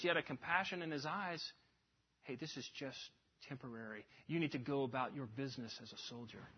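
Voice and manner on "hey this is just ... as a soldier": low, hushed, steady firmness; firm, compassionate. Acted. Old sergeant to a shell-shocked private on the battlefield, firm yet compassionate. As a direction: speak softly but firmly, like a seasoned sergeant. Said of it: "with a firmness in his voice".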